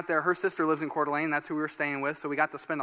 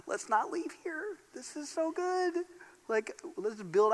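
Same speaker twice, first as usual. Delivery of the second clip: mock happy crying; 10 semitones higher, 6 dB quieter than usual. Overwhelmed by the boardwalk view.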